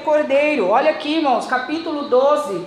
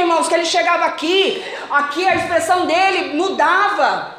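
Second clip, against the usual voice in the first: fervent preaching, building up; fervent sermon delivery; 4 semitones higher, 3 dB louder than usual. Acted. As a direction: preach it with growing fire. Preaching with rising fervor.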